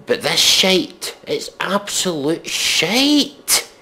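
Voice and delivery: high, slow scornful shite; indignant disgust. Real, not acted. Reviewer damns the song, high and drawn out. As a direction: drag it out in scorn.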